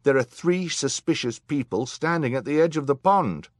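high, loud alarmed report; crying out. A narrator's reading. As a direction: call out the sighting for all to hear. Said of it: "cried a voice".

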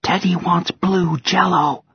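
put-on high, slow child voice; little girl voice. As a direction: ask like a small girl. Child voice for teddy.

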